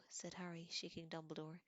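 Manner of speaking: plain reading voice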